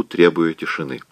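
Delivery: plain reading voice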